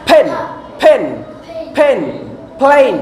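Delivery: "ফেন" and "প্লেন" opened with an airy p, halfway to f; in "ফেন" and "প্লেন", close both lips, then throw the air out; P between p and f. Shown as a demonstration. Teacher shows the class the sound between প and ফ, "ফেন", "প্লেন" with a puffed p. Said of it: "এটার উচ্চারণ হচ্ছে প আর ফ এর মাঝখানে".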